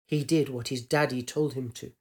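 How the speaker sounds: ordinary delivery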